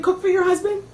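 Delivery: mother's voice. Retold or imitated. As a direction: pitch it up like mom agreeing. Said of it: "They're on the speakerphone with my father saying".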